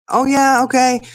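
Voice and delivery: put-on a kid agreeing without listening; imitating son. Son voiced brushing off again.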